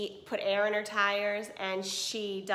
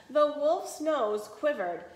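ordinary delivery, nothing marked

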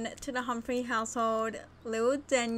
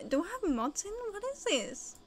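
usual speaking voice

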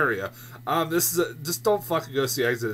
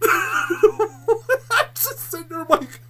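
slow, higher, laughing through it; laughing disbelief; 14 semitones higher, slower than usual. Real, not acted. Reviewer recalling watching the trailer, laughing slowly in disbelief. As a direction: laugh it out, stunned.

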